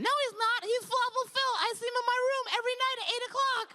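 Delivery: put-on very high-pitched kid, rushing at the end; insistent little boy. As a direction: contradict like an adamant small child. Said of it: "this little boy".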